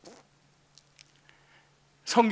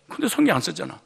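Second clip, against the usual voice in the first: ordinary talk, nothing marked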